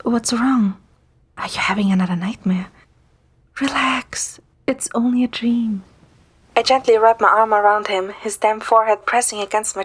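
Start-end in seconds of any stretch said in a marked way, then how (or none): 0.0-5.8 whispered comfort; soothing whisper. Acted. Narrator whispering comfort to Zane during his nightmare. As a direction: hush him out of his nightmare gently. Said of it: "try to calm down".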